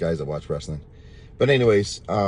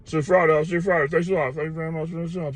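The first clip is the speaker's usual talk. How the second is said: mock big-guy voice, higher and louder, hammed up; big guy impression; 11 semitones higher, 7 dB louder than usual. Burly customer calling goodbye to the salesman, mock booming voice. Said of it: "he's talking from his belly. He got a big, deep voice".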